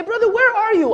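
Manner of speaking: a high teasing "where are you"; playful call to a friend. Retold or imitated. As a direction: call out to a friend, half joking. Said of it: "and you say".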